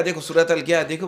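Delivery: ordinary talk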